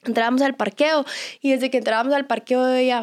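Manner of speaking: usual speaking voice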